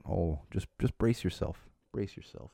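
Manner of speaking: grave, quiet word of caution; hushed warning. Real, not acted. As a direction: warn them under your breath, low. Mock-grave warning to other parents.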